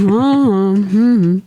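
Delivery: droop it like a sad stuffed donkey; Eeyore voice. Pretend. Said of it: "I sound like Eeyore right now".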